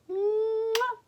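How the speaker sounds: high, exaggerated mwah; playful squeaky smooch; blown kiss. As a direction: send a big noisy kiss to children. Blows a kiss goodbye.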